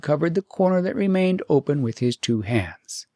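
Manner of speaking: ordinary delivery, nothing marked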